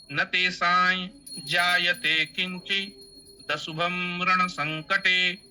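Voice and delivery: slow level chant, तेषां ending in ñ before जायते; palatal nasal recitation. Shown as a demonstration. Teacher modelling the correct sound, slow palatal-nasal recitation. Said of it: "इस तरीके से उच्चारण होगा".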